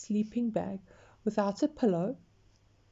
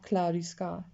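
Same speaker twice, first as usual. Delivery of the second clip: ordinary delivery, nothing marked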